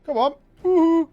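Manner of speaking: high, loud whoop of delight; excited cheer. Real, not acted. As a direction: cheer it on like a fan. Streamer cheering on the scene.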